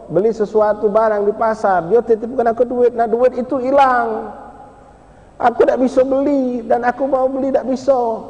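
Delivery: put-on high, slow, frightened child explaining; high slow tearful boy's explanation; tearful child's story. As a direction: tell it as a scared boy near tears. Boy explains lost money, crying.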